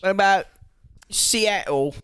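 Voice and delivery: Seattle with a Cockney twang; Cockney accent. Acted. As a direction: ask it like a London Cockney. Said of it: "was that Seattle in a Cockney accent?".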